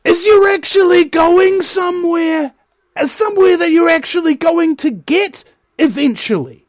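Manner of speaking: impassioned oratory. Real, not acted. Commentator urging listeners onto the highway of truth, impassioned. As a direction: proclaim it high and stretched, like a rally speech.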